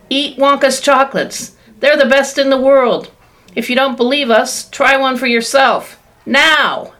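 commercial voice. Pretend. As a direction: sell it like a TV announcer. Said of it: "a voice will say".

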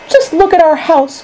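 high, dismayed exclamation; distressed cry. A narrator's reading. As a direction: cry out over the damage. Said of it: "cried poor Mister Bucket".

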